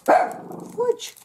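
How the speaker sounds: high, loud exasperated yelp. Real, not acted. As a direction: blurt it out in frustration. Dog barking, sudden outburst.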